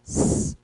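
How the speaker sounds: long hissed S on its own; isolated hissing S; S sound demonstrated. Shown as a demonstration. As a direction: hold the S of cebola. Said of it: "de cebola".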